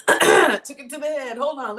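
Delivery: wincing through a brain freeze; strained brain freeze groan. Real, not acted. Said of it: "Ooh, brain freeze".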